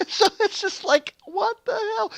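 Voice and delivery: high, squeaky laughing disbelief. Real, not acted. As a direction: giggle through the words, unable to hold it in. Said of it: "trying not to laugh".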